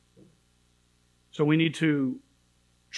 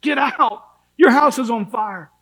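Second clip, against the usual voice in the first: loud, high urgent yell; shouting to someone in a burning house; screaming warning; 6 semitones higher, 7 dB louder than usual. Retold or imitated. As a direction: scream at them to get out. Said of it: "scream out and say".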